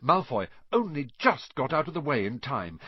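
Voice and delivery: usual speaking voice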